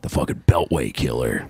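mock ominous. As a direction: drop low for a scary title. Mock ominous killer nickname.

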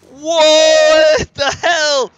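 slow, loud, stretched disbelief; shocked yell. Real, not acted. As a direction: drag out the shock loudly. Shocked at game event.

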